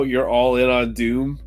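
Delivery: ordinary delivery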